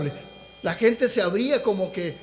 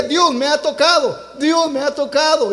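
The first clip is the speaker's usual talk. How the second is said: raised, higher-pitched imitation of tearful testimony; retold tearful cry; 4 semitones higher than usual. Preacher quotes healed woman touched by God, mildly imitated tearful cry. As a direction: retell her overwhelmed cry with mild fervour. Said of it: "comenzó a llorar y decía".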